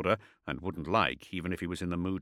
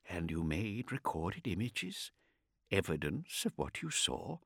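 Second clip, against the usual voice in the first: quiet, intent questioning; 7 dB quieter than usual. A narrator's reading. Kartik presses quietly.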